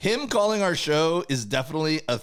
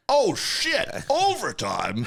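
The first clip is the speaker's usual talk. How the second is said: announcer impression; 7 semitones higher, much slower than usual. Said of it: "Michael Diamante".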